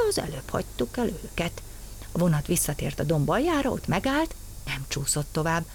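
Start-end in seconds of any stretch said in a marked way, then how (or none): none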